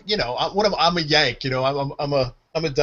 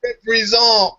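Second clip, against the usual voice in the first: high French Canadian cheer, last syllable stretched; French Canadian accent; 6 semitones higher, slower than usual. Retold or imitated. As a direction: imitate French Canadian kids hyping you up. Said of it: "that French Canadian".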